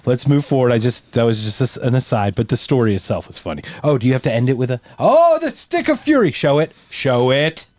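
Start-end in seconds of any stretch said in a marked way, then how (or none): 5.0-6.3 mock epic. Hyping up the stick prop.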